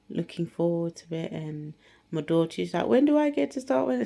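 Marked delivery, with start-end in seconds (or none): 2.9-4.0 eager daughter. Retold or imitated.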